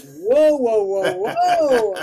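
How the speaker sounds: loud, high "whoa" to settle someone down; loud, soothing "whoa" stretched out; calming shout. Retold or imitated. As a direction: hold your hands up and shout "whoa" to calm things. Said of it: "And Rance was like".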